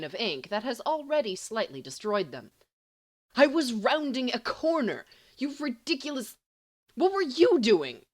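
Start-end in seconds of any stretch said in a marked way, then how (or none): 3.4-8.0 angry. A narrator's reading. Heated quarrel in the hallway.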